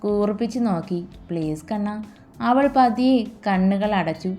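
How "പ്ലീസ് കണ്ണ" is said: low, soft pleading murmur; soft plea. Acted. Hari softly begs Aruna for a kiss, low hushed plea. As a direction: coax her gently, almost under the breath.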